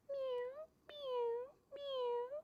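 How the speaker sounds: very high, soft little kitten mews; kitten meow. Acted. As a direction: mew like a tiny frightened kitten. Kitties about to be eaten.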